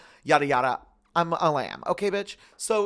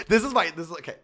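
ordinary delivery

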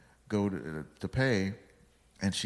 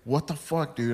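mock-annoyed, higher-pitched protest; imitated mad reaction; 7 semitones higher than usual. Tattoo artist to the fan who annoyed her, mock mad. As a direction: act out her irritation, pitched up. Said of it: "and she got mad".

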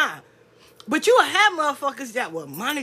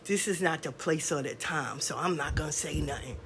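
much lower and softer, hiding behind manners; hushed, low fake propriety; mock prim excuse; 10 semitones lower, 9 dB quieter than usual. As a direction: duck out politely like a coward. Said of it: "this is the one that really gives me".

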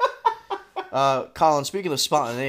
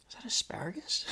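whispering; 9 semitones higher, 8 dB quieter than usual. Retold or imitated. Waking confused, smelling something.

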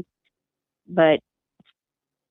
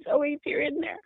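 tearful reassurance; 8 semitones higher than usual. Retold or imitated. Mother calls to missing daughter in the trunk, tearful and reassuring. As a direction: coax a child you fear is trapped, holding back tears.

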